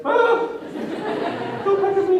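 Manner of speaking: high, loud squealing panic; mock panicked shriek. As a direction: squeal like someone put on the spot. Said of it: "Just shouted".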